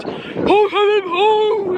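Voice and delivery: mock high-pitched hooting scream; imitated scream. Teasing how a friend screams, high mock hoots. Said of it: "When Siossi screams, he goes".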